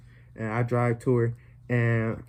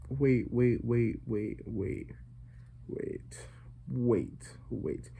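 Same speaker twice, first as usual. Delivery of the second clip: fading pleading waits; 6 dB quieter, much slower than usual. Retold or imitated. Begging after her rejection.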